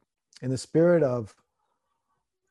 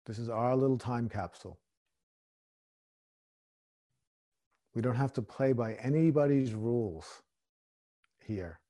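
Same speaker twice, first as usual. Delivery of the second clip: guided meditation; 2 semitones lower, 5 dB quieter than usual. Acted. Meditation close.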